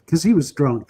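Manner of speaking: usual speaking voice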